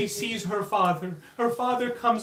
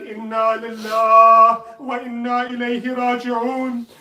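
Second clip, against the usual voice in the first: slow, louder drawn-out Arabic phrase; solemn Arabic recitation; 8 dB louder, slower than usual. Acted. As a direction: intone it slowly and loudly, like a mourner. Imam recites on her death.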